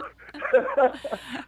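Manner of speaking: a burst of high, delighted laughter; real laughter. Real, not acted. Brother says let's get women, he bursts out laughing. Brother's cheeky line sets him off.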